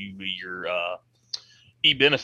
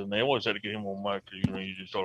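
Boomhauer mumble; 2 semitones lower, 7 dB quieter than usual. Retold or imitated. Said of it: "he just kinda talked like this".

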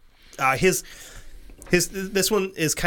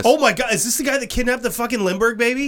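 high, loud shocked realization; 8 semitones higher, 4 dB louder than usual. Real, not acted. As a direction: blurt it out in disbelief. Realizes it's the Lindbergh kidnapper.

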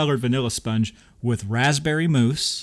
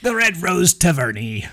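hammy pirate, higher and louder; 7 semitones higher, 6 dB louder than usual. Retold or imitated. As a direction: growl it like a pirate. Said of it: "I kinda used a pirate voice".

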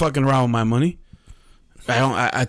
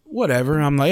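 ordinary talk, nothing marked

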